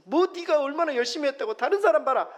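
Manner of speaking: high, loud scolding rebuke; harsh scolding. Retold or imitated. A preacher voicing a harsh God scolding Elijah, loud rebuke. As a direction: scold him like a stern master. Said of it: "이렇게 말하시지 않고".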